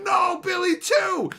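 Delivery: high, loud hammed-up shout; overacted grieving father bellowing; anguished shout. Father losing another kid, shouting in mock grief. Father mourns second child.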